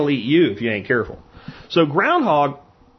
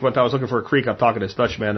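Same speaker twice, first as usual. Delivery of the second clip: ordinary delivery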